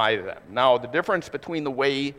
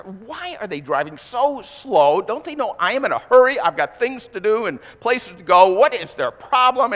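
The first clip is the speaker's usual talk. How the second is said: mock frustrated rant, climbing higher and louder; 6 semitones higher, 5 dB louder than usual. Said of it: "I got really frustrated with the car".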